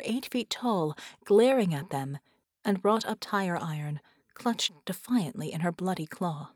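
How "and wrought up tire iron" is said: plain reading voice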